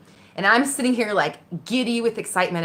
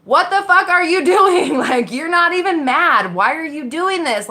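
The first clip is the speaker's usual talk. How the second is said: higher, louder, slow disbelieving yell; alarmed inner voice; 10 semitones higher, 7 dB louder, slower than usual. Retold or imitated. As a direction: shout at yourself in disbelief. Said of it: "two distinct voices".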